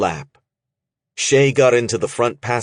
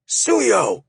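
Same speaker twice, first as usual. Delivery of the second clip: high sharp summoning shout; the Horn calling his fist over; shouted name. A narrator's reading. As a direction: yell for him across the room.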